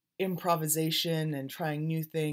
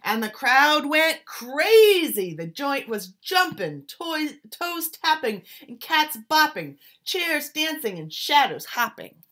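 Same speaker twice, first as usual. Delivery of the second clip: excited narration; 9 semitones higher, 9 dB louder than usual. A narrator's reading. Crowd going wild in club.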